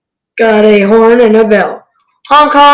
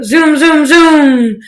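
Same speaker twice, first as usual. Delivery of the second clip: ordinary delivery, nothing marked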